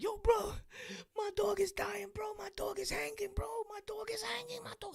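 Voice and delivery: high, whispered, tearful pleading, mock-crying; imitating a crying owner. Owner on the phone telling his friend the dog is hanging, high whispered mock sobbing. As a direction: squeeze it out high and hushed like he's in tears.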